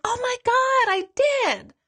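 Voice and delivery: high, excited gasp of recognition; amazed client. Retold or imitated. Client on the phone, stunned the medium is right, high and excited. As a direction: client blurting it out, astonished. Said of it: "she's like".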